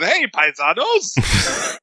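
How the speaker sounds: hammed-up slow Italian accent greeting. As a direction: draw it out in a bad Italian accent. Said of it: "a terrible Italian accent the whole entire movie".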